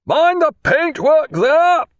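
loud, slow, high hail over the rail; powerful voice. A narrator's reading. Captain hailing the boat crew over the rail, loud. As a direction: call it down to the boat so it carries. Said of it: "he added in a powerful voice".

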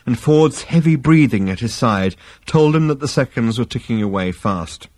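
plain reading voice

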